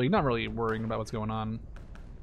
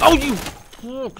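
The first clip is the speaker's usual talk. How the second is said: a sudden high, loud, drawn-out curse; startled curse; 10 semitones higher, 8 dB louder, much slower than usual. Real, not acted. Gamer spotting an enemy player, startled loud curse. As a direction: caught off guard, the words stretched slowly.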